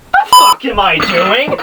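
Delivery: high-pitched, drawn-out self-disbelief; exasperated outburst. Real, not acted. Reviewer abandons his gentleman act, in a high exasperated outburst. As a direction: break character with a high, incredulous cry. Drops the monotone act abruptly.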